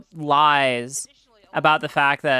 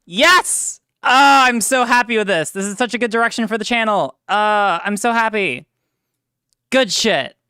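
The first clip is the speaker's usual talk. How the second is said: excited; 5 semitones higher, 6 dB louder, slower than usual. Real, not acted. Thrilled with the new intro video.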